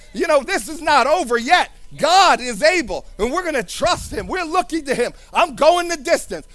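full force preaching. Acted. Preacher proclaims his journey to Jericho, loud fervent preaching. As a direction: proclaim it with fire.

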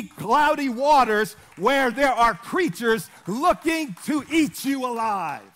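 loud, driving sermon delivery; forceful preaching. Real, not acted. Preacher telling church a mother is never your friend, loud and forceful.

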